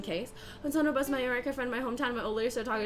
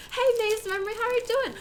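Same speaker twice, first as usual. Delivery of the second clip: a light, much higher Southern American twang in a greeting; mock Southern accent; 12 semitones higher, 4 dB louder than usual. Shown as a demonstration. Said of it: "that Southern accent is very similar to".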